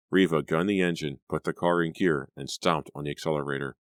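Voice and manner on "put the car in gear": usual speaking voice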